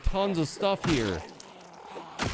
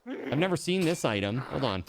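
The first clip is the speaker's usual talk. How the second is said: usual speaking voice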